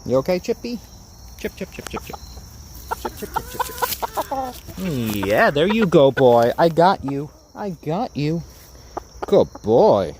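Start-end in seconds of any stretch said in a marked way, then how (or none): 1.4-5.3 very high, soft chip-chip calling; high soft chicken-calling chant. Real, not acted. Calling the rooster by name. 5.3-10.2 louder, higher praise to a rooster; loud cheerful pet praise; praising a pet. Real, not acted.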